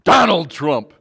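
angry yell. Retold or imitated. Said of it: "they might yell out".

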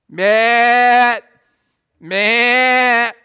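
loud, high shout of a name; calling out. Real, not acted. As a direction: yell across the room for him. Calling out loudly to Matt.

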